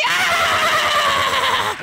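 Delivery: shrill squeal of a puppet wolf; high puppet shriek. Acted.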